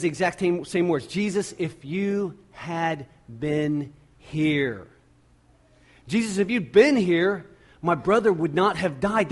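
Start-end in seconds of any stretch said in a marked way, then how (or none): 1.1-4.9 each word of "Jesus, if you had been here" drawn out; slow, weighted plea. Acted. Retelling Mary's words, first take. 6.1-9.3 raised-voice declaration. Acted. Same words repeated with raised voice.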